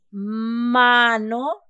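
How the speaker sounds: mano with a long, loud ma; exaggerated first-syllable stress on mano. Shown as a demonstration. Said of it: "ma es la sílaba tónica".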